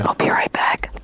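whisper. Real, not acted. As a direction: breathe it out in a whisper. Repeated as whisper.

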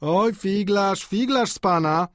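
high, drawn-out teasing; joking. A narrator's reading. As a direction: tease playfully, stretching the first words. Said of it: "powiedział malarz żartobliwie". (żartobliwie, przeciągle, wysokim głosem)

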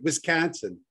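put-on Chicago vowels; Chicago accent. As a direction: tease with an imitated Chicago accent. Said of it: "with your Chicago accent".

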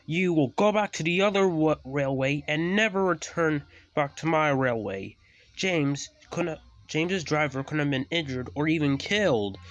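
high, forceful scolding; thundering rebuke. A narrator's reading. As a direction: banish the culprit at full voice. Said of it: "he thundered loudly".

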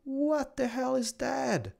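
high, slow, drawn-out bafflement; stretched-out, high-pitched astonishment; amazed disbelief. Real, not acted. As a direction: gape at something bizarre. Baffled by strange moving bushes.

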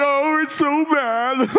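high, drawn-out hammed-up wail; mock crying. Preacher imitating everybody crying at the tomb, mock crying. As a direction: bawl it slowly, breaking into fake sobs. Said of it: "And everybody's crying".

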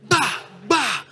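high vocal smack noises, twice; imitated blows. Robbers beating him into the car, imitated blows. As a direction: sound out two quick blows. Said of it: "Pushed me in the back seat".

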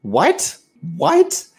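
imitated incredulous mother, questions rising; retold shock. Mom told of the arrest, shocked disbelief.

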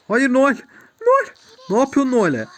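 real alarm for a teammate; alarmed yell. Real, not acted. Teammate attacked, cries out. (takım arkadaşı saldırıya uğrayınca yüksek sesle panik çığlığı)

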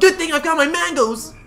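high, loud mock-triumphant cry; hammed-up, high-pitched announcement; cartoon character exclamation. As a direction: burst out high like an excitable cartoon animal. Acting out game character's line.

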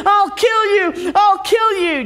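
high, loud furious threat; giant screaming. Acted. Giant waking up furious at Jack. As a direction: yell it like a raging giant. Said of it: "he begins screaming".

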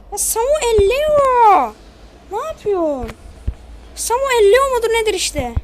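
shouting at a teammate on screen; exasperated yell. Real, not acted. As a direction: bellow the name in disbelief. Yelling at player Samuel Leo.

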